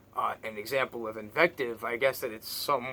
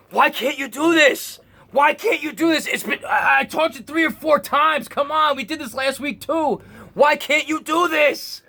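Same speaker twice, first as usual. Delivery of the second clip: put-on much higher and louder, an exasperated shout at a kid; frustrated parent yelling; 12 dB louder than usual. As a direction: yell like a parent who has lost patience. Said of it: "get just totally upset and lose it".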